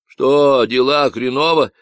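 loud, nasal Texan ranting about ecologists; a cocky stranger sounding off; Davy's Texan voice. Acted. Stranger on the radio ranting about ecologists, nasal Texan.

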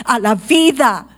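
ordinary delivery

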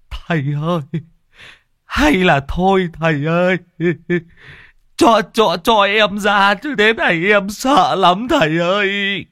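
high, tearful begging, rising to a loud wail; a terrified man begging to be freed; whimpering plea. A narrator's reading. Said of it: "Chú Rêu cứ mếu máo". (mếu máo van xin, the thé)

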